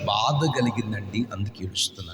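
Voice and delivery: very soft, sorrowful reply; a quiet grieving whisper of a voice; sad weeping person. Acted. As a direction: speak as someone broken by pain.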